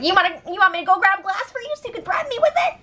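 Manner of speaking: squeaky high, fast mock of a whiny guy. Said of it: "So then Matt's like".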